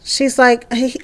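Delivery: normal talking voice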